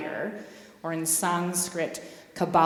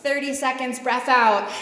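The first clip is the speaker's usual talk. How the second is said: louder call to breathe out; coaching cue; 5 semitones higher, 6 dB louder than usual. Shown as a demonstration. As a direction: push the class to breathe out, louder. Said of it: "and then breath out through the belly".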